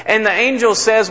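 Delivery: ordinary talk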